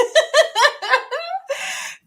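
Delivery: high, loud burst of laughter; a real laugh bursting out high. Real, not acted. Laughs at four hundred pages.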